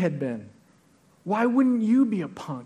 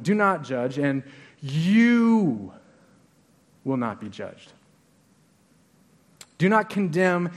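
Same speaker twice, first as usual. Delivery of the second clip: "you" drawn out and set apart by pauses; stress on YOU; slower than usual. Shown as a demonstration. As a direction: lean long on each "you", then let the rest drop away. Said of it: "we're gonna put the emphasis on a word".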